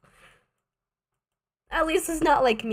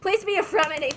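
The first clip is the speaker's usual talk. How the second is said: higher, louder desperate plea; pleading; 7 semitones higher, 5 dB louder than usual. Real, not acted. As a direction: beg the pull to land. Begging for a character pull.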